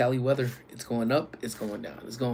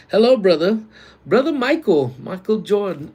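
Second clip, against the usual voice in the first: loud, high hype greeting; excited greeting; 8 semitones higher, 8 dB louder than usual. Real, not acted. Streamer hails brother Michael in chat, excited greeting. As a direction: boom out a high hello to a buddy. Greeting a viewer who joined.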